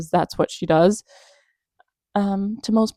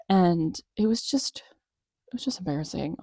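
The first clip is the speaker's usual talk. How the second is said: hushed cringe; 7 dB quieter than usual. Real, not acted. Cringing over Amber's interview.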